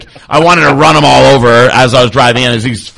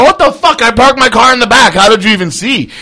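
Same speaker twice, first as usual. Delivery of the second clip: shocked; 9 semitones higher, 3 dB louder than usual. Retold or imitated. Shocked at friend's call.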